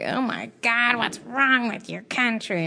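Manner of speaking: a touch of a tipsy, accusing stranger; imitating drunk woman. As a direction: lightly mimic her blunt drunken outburst. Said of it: "she was just like".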